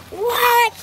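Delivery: high, startled what; shocked. Acted. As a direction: yelp it in disbelief. Mom shocked by grandpa's answer.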